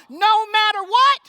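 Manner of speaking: high, loud war call; rally the crowd with it; battle cry. Acted. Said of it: "I'm gonna give you the war call".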